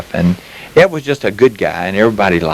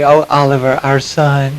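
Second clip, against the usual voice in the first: Lisa; 5 semitones higher than usual. Retold or imitated. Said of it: "Lisa would say".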